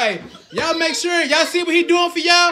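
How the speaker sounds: loud, high, laughing call; rally the viewers loud and high; excited hype. Real, not acted. Dad hypes the viewers with a loud, laughing call.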